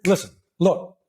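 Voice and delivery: firm, attention-grabbing command; short, firm interjection; pattern interrupt. Shown as a demonstration. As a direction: break in abruptly to stop them. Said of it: "I interrupt their pattern".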